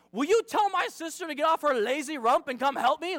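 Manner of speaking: high, loud exasperated demand; acted tattling outburst; barely keeping it together. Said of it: "she's trying so hard to keep it together".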